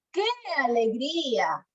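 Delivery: demonstrated exclamation of delight; stretched, swooping cry of joy; emotive joy. Shown as a demonstration. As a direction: act out happiness for the class. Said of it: "Estoy expresando emociones".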